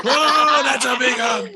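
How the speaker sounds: loud high laughing whoa; jolly dwarf swept up in a hug; surprised dwarf. Acted. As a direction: yelp as he's lifted off the ground. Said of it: "And he's like".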